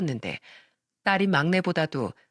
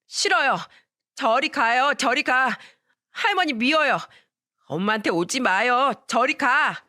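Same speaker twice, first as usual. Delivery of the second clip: put-on higher child voice, stubborn and upset; a little kid shouting no at grandma; boy pushes grandmother away, high upset child voice; small boy refusing; 5 semitones higher than usual.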